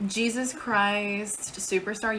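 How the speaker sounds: ordinary delivery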